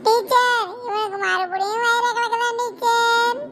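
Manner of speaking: high, slow sing-song call; pupil telling on friend. Acted. Pupil reports friend's stomach trouble, high drawn-out call. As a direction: call to teacher, dragging out the words. Pupil calls teacher again.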